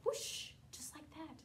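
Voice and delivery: hushed whoosh; whispered whoosh. A narrator's reading. Storyteller showing children the storm stopping, whispered. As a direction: breathe the whoosh out.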